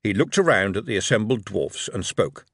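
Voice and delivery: usual speaking voice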